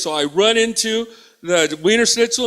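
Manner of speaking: usual speaking voice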